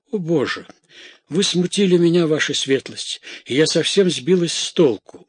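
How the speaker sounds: high, agitated complaint; flustered protest. A narrator's reading. Maid thrown off by her lord's interruption, flustered.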